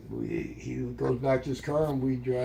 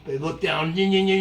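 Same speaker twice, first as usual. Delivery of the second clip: ordinary delivery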